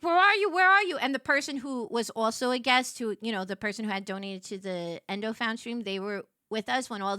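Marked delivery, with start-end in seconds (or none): 0.0-1.0 urgent calling. Retold or imitated. Sister calling urgently by phone.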